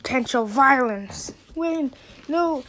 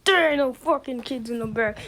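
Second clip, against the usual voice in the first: normal talking voice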